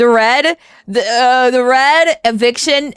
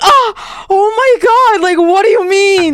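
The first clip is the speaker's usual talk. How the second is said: much higher, louder mock-shocked cries; astonished high exclaim; 7 semitones higher, 4 dB louder than usual. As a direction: act out your jaw-dropping surprise. Replaying his own astonished reaction.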